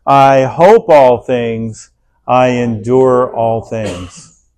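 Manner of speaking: slow, loud, measured group recitation; congregation reading aloud. Acted. Pastor leading the church in reading, slow and loud. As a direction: lead the room through it word by word. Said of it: "Let's go".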